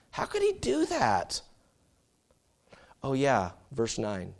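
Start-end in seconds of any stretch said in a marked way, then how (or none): none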